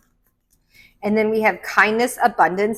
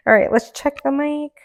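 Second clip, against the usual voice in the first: ordinary delivery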